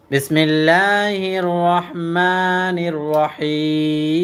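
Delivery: very slow, drawn-out melodic tilawat; Quran recitation. Acted. Teacher to students, beginning Surah Qadr as melodic recitation. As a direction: recite as in prayer, each vowel stretched long.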